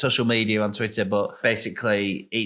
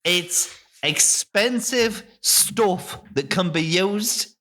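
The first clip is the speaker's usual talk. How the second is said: very slow, high, vaguely Irish farmer; Farmer Buggerton; 6 semitones higher, much slower than usual. Acted. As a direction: lecture grandly as a rustic farmer, drawing words out. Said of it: "why is he Irish?".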